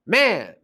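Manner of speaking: MAN lifted high as the strong beat; Man stretched with a high accent; stressed. Shown as a demonstration. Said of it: "that's where the stress is".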